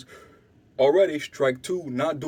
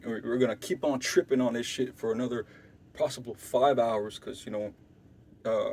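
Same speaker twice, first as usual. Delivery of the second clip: hushed retelling; 5 semitones lower, 6 dB quieter than usual. Retold or imitated. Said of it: "Korea goes".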